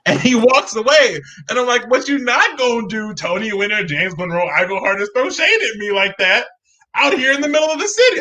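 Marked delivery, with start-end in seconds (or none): 1.9-8.2 mock outraged yell. Teen calls out his idol for throwing shade, mock wail. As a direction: play up the hurt like a diva.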